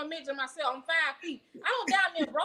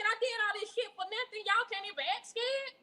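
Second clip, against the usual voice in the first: exasperated mom; 7 semitones higher, 5 dB quieter than usual. Retold or imitated. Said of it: "I said".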